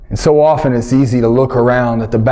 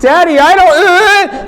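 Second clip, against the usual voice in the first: put-on high, slow, loud gagging child; a kid throwing up; higher, louder straining wail; retching child; 5 dB louder, much slower than usual. Daughter retching.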